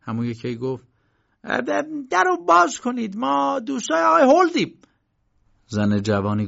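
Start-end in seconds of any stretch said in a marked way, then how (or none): 1.4-4.7 high, loud uncle calling at the door; impatient uncle. A narrator's reading. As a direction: call out high and loud to be let in.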